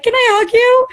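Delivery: high, loud gushing; excited. Retold or imitated. Host meeting a fan in an ice cream shop, high and excited. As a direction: burst out with it in delight.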